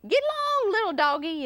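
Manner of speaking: long whooping yell that drops down. Acted. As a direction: holler high to drive cattle on. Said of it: "yelled Zork".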